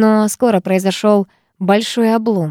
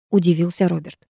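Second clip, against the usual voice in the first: ordinary delivery, nothing marked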